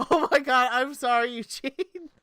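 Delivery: squeaky, high laughing speech; real giggling fit; laughing hard. Real, not acted. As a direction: talk through helpless laughter.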